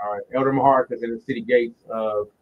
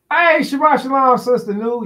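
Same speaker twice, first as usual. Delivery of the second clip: loud greeting; 11 semitones higher, 6 dB louder than usual. Real, not acted.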